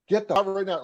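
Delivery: high-pitched mock yell; retold screaming. Boss throwing a wrestler out of the locker room, mock screaming. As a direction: imitate a boss blowing up, pitched well up. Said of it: "he started screaming".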